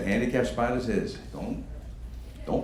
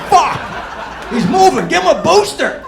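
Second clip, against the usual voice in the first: high, loud panicked yelling; panicked shout; 9 dB louder than usual. Retold or imitated. Group yells in panic as the old man survives. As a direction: scream it like the monster won't die.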